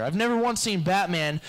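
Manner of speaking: ordinary talk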